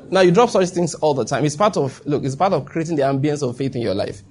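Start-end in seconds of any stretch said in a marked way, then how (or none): none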